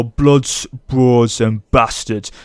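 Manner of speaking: slow northern-English actor accent impression. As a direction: draw out each word in an imitated accent. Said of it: "I'm gonna say this in a [name] accent".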